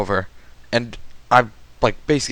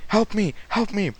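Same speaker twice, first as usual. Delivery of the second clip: high-pitched imitated cry for help; put-on distress, pitched up high; mock plea for help; 8 semitones higher, 3 dB quieter than usual. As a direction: imitate a friend calling for rescue. Retold plea of grabbed friend.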